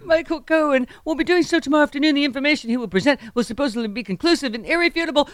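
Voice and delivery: mocking high-pitched politician's voice; high, put-on caricature of a ranting politician; Trump. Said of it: "real voice is actually that high pitched".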